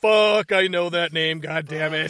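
shocked outburst. Retold or imitated. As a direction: blurt it out, caught off guard.

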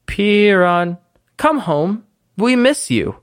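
high, drawn-out motherly plea; coaxing mother. Acted. Illusion of a mom calling her child home, coaxing. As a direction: gently lure the child home.

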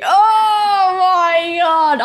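high, loud, drawn-out anguished wail; real cringe cry, stretched long; cringing wail. Real, not acted. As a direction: groan it out in agony of cringe. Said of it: "I feel horrible".

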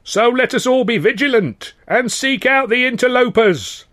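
high, loud rabble-rousing oration; fiery speech. Acted. Union orator warning dockers about the owners, loud and fiery. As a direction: whip the crowd into fury.

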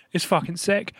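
ordinary delivery

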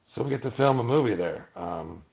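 normal talking voice

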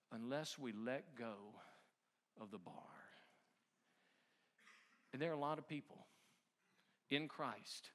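hushed preacher's closing; solemn sermon point. Acted. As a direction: lay the lesson down gravely, with long pauses. Preaches the lesson slowly.

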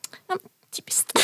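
put-on whispered toddler copy of ja men typiskt; child imitating. Three-year-old Charles parroting his mum, whispered copy. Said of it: "Charles där, tre år, bara".

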